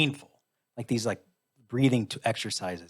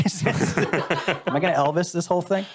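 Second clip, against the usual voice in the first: laughing; 5 semitones higher than usual. Retold or imitated. Joking about dying on toilet.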